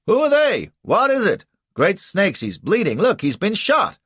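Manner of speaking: high, loud startled shouts; alarmed calls. A narrator's reading. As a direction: cry out in alarm. Townsfolk calling out in alarm.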